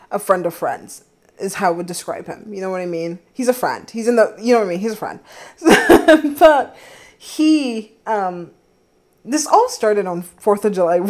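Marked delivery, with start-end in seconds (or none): 5.2-6.3 a loud, high laugh. Real, not acted. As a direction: laugh off the awkward label. Laughs at friend label.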